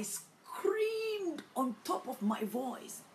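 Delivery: SCREAMED stretched long and high; high, dragged-out SCREAMED; drawn-out emphasis. Retold or imitated. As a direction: draw out "screamed" high and slow.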